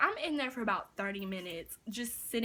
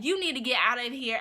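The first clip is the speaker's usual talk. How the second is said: louder, firm retold yell; 7 dB louder than usual. Manager ordering the man out, louder retold yell. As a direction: imitate a manager throwing someone out. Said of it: "she was just yelling".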